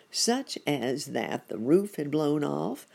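usual speaking voice

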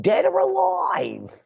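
mock cowboy boast. Mocking Bush's war vow.

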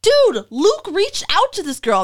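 high, loud, drawn-out astonished call; amazed announcement. Retold or imitated. Contestant calling to others in line, loudly amazed.

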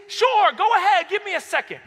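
loud, high, reckless challenge; defiant dare. Retold or imitated. Student daring the teacher to add a check mark, defiant shout. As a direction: throw it back at the teacher, loudly.

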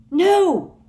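high, loud shriek of protest. A narrator's reading. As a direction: scream it out in horror. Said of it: "shrieked Hermione".

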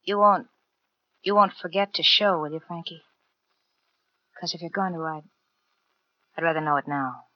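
soft, hesitant plea with pauses; anxious pleading. Acted. Girlfriend asks crook not to abandon her, softly anxious. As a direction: ask quietly, afraid of the answer.

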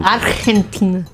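"Argentina" with a throaty Spanish j sound; Latin accent. Pretend. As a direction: say "Argentina" as ar-hen-TEE-na. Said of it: "my impeccable Latin accent".

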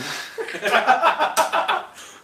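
loud, high burst of laughter; laughing. Real, not acted. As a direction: laugh long at your own joke. Laughing at own joke.

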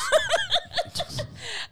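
high laugh. Real, not acted. Laughs at ketchup rant.

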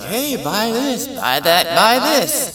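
high, slow salesy pitch; pushy ad voice. Retold or imitated. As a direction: hawk it like a TV ad. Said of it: "Every commercial is like".

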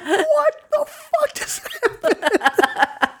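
very high, slow, cracking with laughter; laughing disbelief. Retold or imitated. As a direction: squeal it out half laughing, half crying in disbelief. And I'm just like.

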